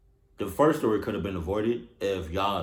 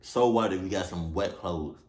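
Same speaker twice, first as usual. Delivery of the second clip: ordinary talk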